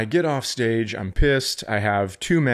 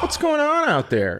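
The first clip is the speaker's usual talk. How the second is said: incredulous question. Retold or imitated. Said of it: "I'm like".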